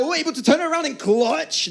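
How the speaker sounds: sudden jump way up in pitch; yelped reaction to the clutch; surprised clutch call. Real, not acted. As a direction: catch the surprise as he turns it. Sudden clutch play called.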